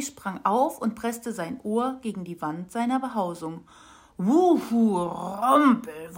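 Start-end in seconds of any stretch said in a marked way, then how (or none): 4.2-6.2 dumpfes, lautes Wummern aus dem Baum; wummernd. A narrator's reading. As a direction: lass es aus der Wand dröhnen. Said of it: "wummerte es". (Grollen aus dem Baumstamm, lautes Wummern)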